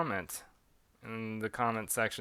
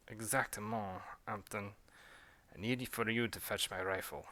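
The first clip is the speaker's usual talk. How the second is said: put-on quiet French accent; soft French accent giving an order; 2 semitones lower, 7 dB quieter than usual. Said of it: "a British and French accent".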